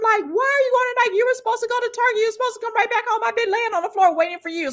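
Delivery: high, fast retold scolding; going off. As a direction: re-enact going off on someone in a rapid high pitch. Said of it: "So I'm just going off".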